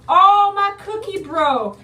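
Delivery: high, loud, slow dismay; oh no, the cookie broke; disappointed surprise. Real, not acted. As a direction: wail over the broken cookie.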